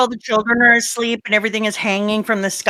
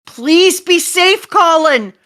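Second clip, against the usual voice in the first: high, loud, slow cry; drawn-out pleading for someone's safety; desperate plea; 6 semitones higher, 5 dB louder, slower than usual. Acted. Parent begging Colin be safe, desperate. Plea for endangered child, acted.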